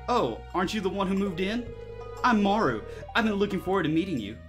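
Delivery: put-on high, bright girl's voice; hammed-up cheerful young woman; bad acting. Voicing Maru's dialogue.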